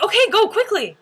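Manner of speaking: high, loud order; mock urgent command; hurrying ajumma. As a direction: imitate a bossy ajumma rushing you. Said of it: "And she's like".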